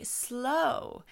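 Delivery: drawn-out, falling "slow"; yellow light slow. Acted. Yellow light slowing the cars, drawn out. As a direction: stretch the word to ease the cars down. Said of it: "yellow light needs to show up to say".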